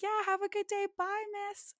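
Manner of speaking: high, bright drive-thru worker voice, friendly sendoff; chirpy cashier. Retold or imitated. Cashier telling her to have a good day, high and chirpy.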